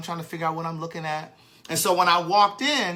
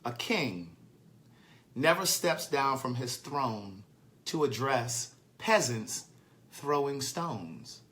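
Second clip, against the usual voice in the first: slow, soft, weighty recital of a proverb; slow sage-like quoting; solemn proverb; 3 semitones lower, 5 dB quieter, much slower than usual. Retold or imitated. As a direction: lay out the old saying slowly like wisdom. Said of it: "proverb that says".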